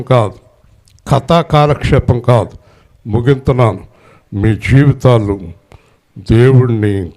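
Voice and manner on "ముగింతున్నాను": usual speaking voice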